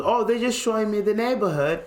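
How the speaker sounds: high, put-on British voice; British accent. Friend giving cops his excuse, high British accent. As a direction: play the innocent British visitor. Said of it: "he's just talking like that".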